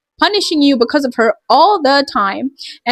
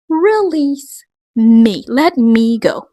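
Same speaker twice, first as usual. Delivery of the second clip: a very slow, drawn-out "Release me"; slow plea; much slower than usual. Acted. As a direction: plead to be released, word by word. Pleading to be let go.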